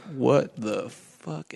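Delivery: slow, drawn-out stunned "what the fuck"; stunned disbelief. Retold or imitated. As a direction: let it hang, mildly dumbfounded. Stunned boy finds hidden hallway.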